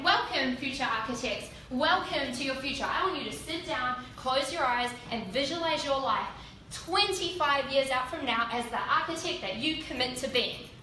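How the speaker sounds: loud, rising welcome to the class; booming lecturer welcome. Retold or imitated. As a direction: address the hall like a lecturer. Lecturer addresses new students.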